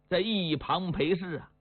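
ordinary delivery

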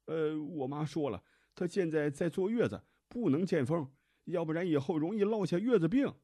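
put-on high, loud young man making excuses; arguing in defence. A narrator's reading. Husband keeps arguing with the doctor, high and insistent. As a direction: plead your case loudly, pitched right up. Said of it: "男子继续辩解道".

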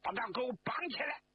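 快而高的厉声命令; fast, high barked order; 命令喊. A narrator's reading. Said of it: "谭平山用手一指张国焘". (厉声下令)

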